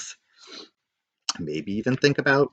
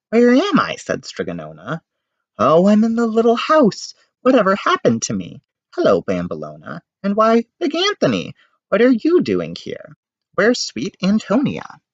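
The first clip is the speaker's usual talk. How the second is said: confused witch; 9 semitones higher than usual. A narrator's reading.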